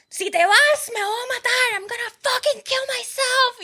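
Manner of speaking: mocking the guy screaming with a gun; imitating him yelling. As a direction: ham up a coked-up man threatening himself. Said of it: "y él".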